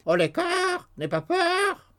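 very high squawking bird voice; raven's cry. A narrator's reading. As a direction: crow it like a talking raven. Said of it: "il cria plusieurs fois".

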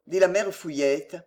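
ordinary delivery

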